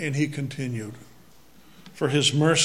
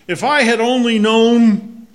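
exasperated outburst; 6 semitones higher, 10 dB louder than usual. Retold or imitated. Frustrated project lament.

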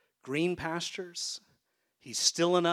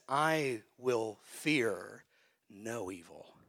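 slow solemn quote; 4 semitones lower, much slower than usual. Acted. Quoting the psalm slowly.

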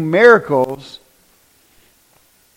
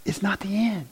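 soft whispered reveal; whispered, solemn; hushed whisper; 5 semitones higher, 8 dB quieter than usual. Acted. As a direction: drop to a breath to land it. Solemn point in sermon.

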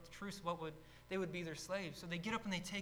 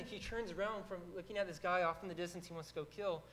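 ordinary talk, nothing marked

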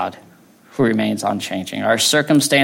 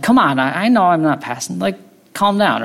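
irritated driver's protest, pitched higher; mock annoyance; 7 semitones higher than usual. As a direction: imitate a driver getting fired up. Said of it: "you get so angry".

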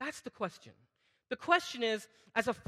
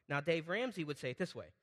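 usual speaking voice

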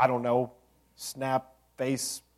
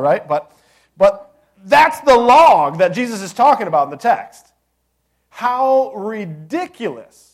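fired-up preaching; 6 semitones higher, 10 dB louder than usual. Acted. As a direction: marvel at it, then mock the blindness. Caber tossing tangent, fired up.

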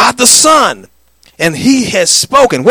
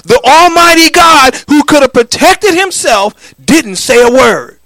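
preaching climax; 6 semitones higher, 4 dB louder than usual. Acted.

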